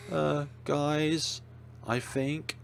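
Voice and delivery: timid, shaky and hesitant; quavering nervous voice. Acted. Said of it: "Shiharu's shaky voice".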